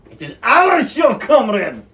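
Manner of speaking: put-on high, loud Russian accent. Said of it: "I said it like a Russian accent".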